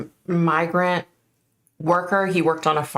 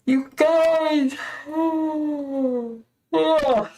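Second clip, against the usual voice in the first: yawning sigh; 11 semitones higher, much slower than usual. Real, not acted. Sighing about yawning.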